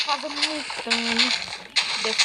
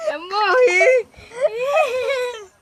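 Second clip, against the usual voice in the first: raging gamer; 10 semitones higher, 8 dB louder, much slower than usual. Real, not acted. Dies in the game.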